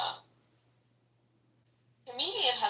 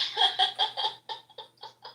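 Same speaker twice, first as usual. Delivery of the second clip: high, breathy burst of laughter; 12 semitones higher, 3 dB louder than usual. Real, not acted. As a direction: crack up in a long high laugh. Laughing at the dating profile story.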